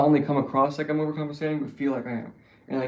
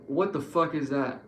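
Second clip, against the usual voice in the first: usual speaking voice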